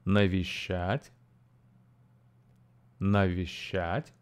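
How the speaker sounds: Навещать slowly for the class to repeat; slow teacher model; slow model word. Shown as a demonstration. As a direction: say Навещать slowly, clearly, for repetition. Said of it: "listen again and repeat".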